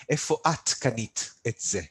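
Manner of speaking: each word laid out clearly for learners; measured classroom delivery, every syllable placed; careful teaching read. Shown as a demonstration. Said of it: "say it again as if it's an actual language".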